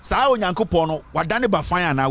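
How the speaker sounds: normal talking voice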